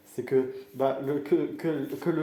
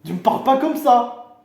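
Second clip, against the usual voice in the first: loud, raised-pitch telling-off; offended outburst; 10 semitones higher, 10 dB louder than usual. Acted. Offended thug candidate warning his rival, loud and angry. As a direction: warn him off loudly.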